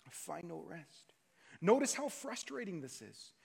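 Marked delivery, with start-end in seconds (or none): 0.0-1.1 soft, dropped-down repeat of the phrase; quiet echo. Shown as a demonstration. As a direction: let "find no rest" sink away quietly.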